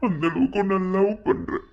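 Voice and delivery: high-pitched crying plea; weepy, desperate confession of love; tearful pleading. Acted. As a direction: beg through tears to be understood. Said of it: "அழுகிறத நிறுத்து".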